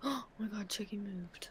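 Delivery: gasping, breathless whisper of mock fright; hushed and spooked, like the doll moved; scared whisper. Pretend fright at doll moving.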